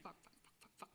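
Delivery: hushed, repeated swearing under the breath; very soft retold panic, trailing away; panicked muttering. Watching cop open the trunk, hushed panic.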